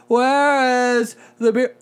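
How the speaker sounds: slow, high, slurred drunk imitation. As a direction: ham up a drunk asking for beer. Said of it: "you sound like a drunk person".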